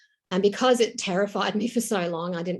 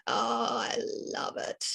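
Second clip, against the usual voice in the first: breathy hushed whisper of delight; soft, slowed, whispered awe; 9 semitones lower, 6 dB quieter than usual. Retold or imitated. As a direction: whisper it, awestruck at the fabric. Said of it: "I just went".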